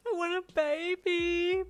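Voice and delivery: hammed-up wail, high, loud and drawn out. A narrator's reading. Boyfriend begging for a baby, theatrical high wail. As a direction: wail it like an overgrown crybaby. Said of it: "Brian wailed".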